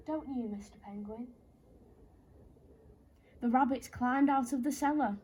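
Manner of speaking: ordinary delivery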